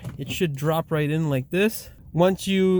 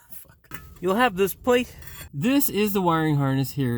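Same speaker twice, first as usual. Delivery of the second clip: ordinary talk, nothing marked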